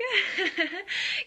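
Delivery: loud, high derisive laugh; mocking laugh. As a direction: burst out laughing at the listener. Mocking the listener.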